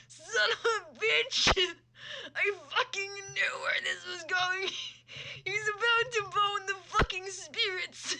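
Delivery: high, loud, slow laughing yell; laughing outburst. Real, not acted. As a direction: shout it out while cracking up. Laughing outburst at predictable twist.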